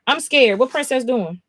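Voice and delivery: ordinary talk, nothing marked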